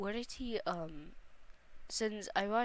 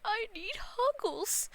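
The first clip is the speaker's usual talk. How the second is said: pleading for huggles; 12 semitones higher, 7 dB louder, slower than usual. Acted. Reading the sad character's plea.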